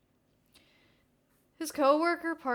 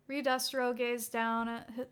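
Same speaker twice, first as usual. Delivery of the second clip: ordinary delivery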